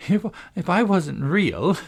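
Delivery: high, halting, tearful half-laugh; half laughing through tears. A narrator's reading. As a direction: stammer it slowly between laugh and sob. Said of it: "half laughing through her tears".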